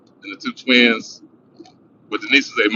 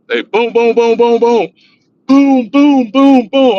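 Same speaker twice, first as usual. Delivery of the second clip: loud high boom boom of fists on a door; door banging; 12 semitones higher, 7 dB louder, much slower than usual. Retold or imitated. Cops pounding on the front door, loud booms.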